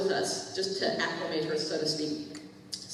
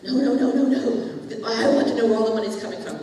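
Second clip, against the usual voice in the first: insistent refusal; 3 semitones higher, 6 dB louder than usual. Retold or imitated. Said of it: "he's in the realm of going".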